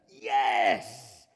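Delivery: low, loud triumphant yes; excited yes. Pretend. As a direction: punch the air yes. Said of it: "Maybe it's a".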